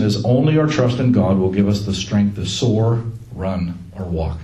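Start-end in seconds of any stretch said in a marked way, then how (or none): none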